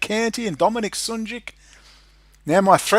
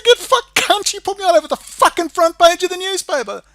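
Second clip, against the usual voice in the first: high, loud angry yelling; furious retort; 11 semitones higher, 6 dB louder than usual. Retold or imitated. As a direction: throw the accusation back at full volume. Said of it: "Yeah, I'm fucking angry".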